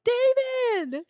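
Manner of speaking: high, loud, delighted call back; excited greeting shout. Retold or imitated. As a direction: yell his name like spotting an old friend. Calling back to the guard.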